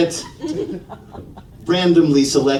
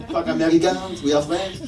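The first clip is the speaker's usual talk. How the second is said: put-on disdainful French accent, a bit softer; 5 dB quieter than usual. Comedian as the French dismissing Americans, French accent. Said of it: "The first ones that came to mind were the French".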